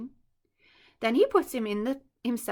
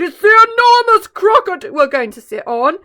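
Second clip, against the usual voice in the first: bellowing; 8 semitones higher, 14 dB louder than usual. Acted. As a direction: bellow the warning. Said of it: "he bellowed".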